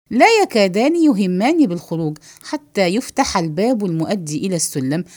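ordinary talk, nothing marked

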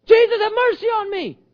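high, loud cry for mercy; yelling. Acted. Blind man defying the crowd, yelling to Jesus. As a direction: holler over a disapproving crowd. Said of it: "Stop yelling".

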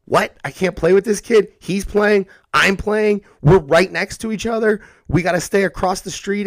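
put-on upset little kid. Host playing a child upset about distancing, high kid voice. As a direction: protest like a kid who can't play with his friend.

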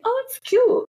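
squeaky high "oh it's cute"; mock-sweet dismissal, way up high; high mock-dismissive. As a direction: toss it off in a tiny high voice. Her own dismissive reply quoted.

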